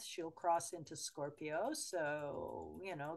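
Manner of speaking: normal talking voice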